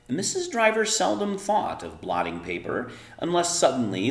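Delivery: expressive read-aloud storytelling; story narration. A narrator's reading. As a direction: read it to the kids like a bedtime story.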